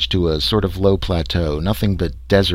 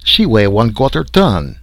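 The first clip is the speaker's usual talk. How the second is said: put-on loud, slow, higher French accent; exclaiming French biologist; 4 semitones higher, 6 dB louder than usual. A narrator's reading. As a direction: protest loudly in a French accent. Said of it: "exclaimed the little biologist Leroy".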